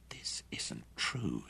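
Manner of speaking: slow, breathless whisper of disbelief; stunned whisper. A narrator's reading. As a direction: barely breathe the words, in shock. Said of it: "he whispered".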